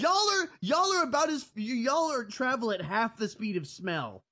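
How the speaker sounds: high squeaky teasing voice; high mocking taunt. Teasing the group as slow.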